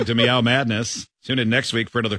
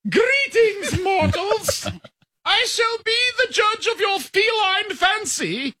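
lofty, high, slow royal cat; high-pitched regal voice; slower than usual. Acted. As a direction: proclaim like a pampered queen. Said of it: "Played with a high-pitched regal voice".

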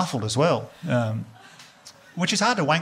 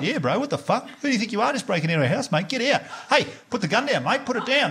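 higher-pitched mock protest at intruders; alarmed guy next door; 7 semitones higher than usual. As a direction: act out a bloke confronting a burglar. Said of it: "And the guy was like".

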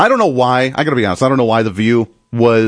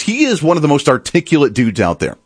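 ordinary talk, nothing marked

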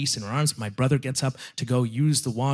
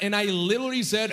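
normal talking voice

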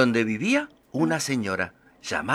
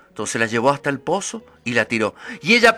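normal talking voice

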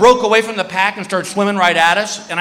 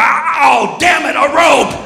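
frustrated shark; 8 semitones higher, 3 dB louder, slower than usual. Pretend. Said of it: "he's gonna get the rope and go".

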